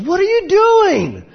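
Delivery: high, loud, slow shout; angry shout. Retold or imitated. Confronting the speeding driver in the parking lot, high shout. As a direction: call him out, loud and high.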